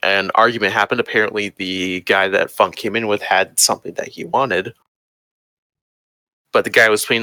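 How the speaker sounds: ordinary delivery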